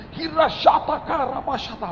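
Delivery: high, loud burst of tongues; praying in tongues. Acted. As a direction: rattle off mock prayer in tongues. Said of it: "Doa pakai bahasa roh".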